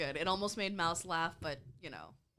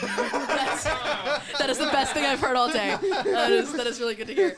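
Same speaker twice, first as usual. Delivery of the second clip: loud, high, through real laughter; delighted laughing outburst; laughing hard; 6 semitones higher, 11 dB louder than usual. Real, not acted. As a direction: gasp out praise while cracking up. Cracking up at the radio message.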